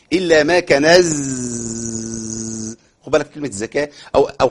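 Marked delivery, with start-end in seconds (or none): 0.1-2.8 "إلا ما كنز" drawn out very slowly, with a buzzing ز at the end; clear zay. Shown as a demonstration. Teacher warning students not to turn zay into seen. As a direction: stretch "كنز" very slowly and make its ز buzz. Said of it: "مش كنس".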